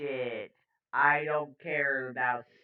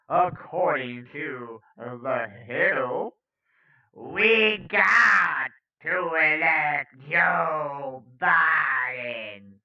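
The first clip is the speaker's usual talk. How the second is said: slow, swelling villain proclamation; hammed-up, drawn-out decree; Palpatine voice; 6 dB louder, much slower than usual. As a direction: stretch every word like an evil emperor. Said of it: "maybe that's more of a Palpatine voice".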